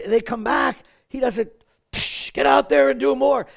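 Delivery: mock dismissive. Contrast: what Jesus does not say.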